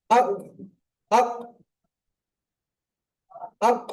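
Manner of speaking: আক with a bouncing echo on the final ক; আক with the stop released hard; qalqala demo. Shown as a demonstration. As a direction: clip আক and let the ক bounce out. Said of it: "mute অবস্থায় বলেন আক".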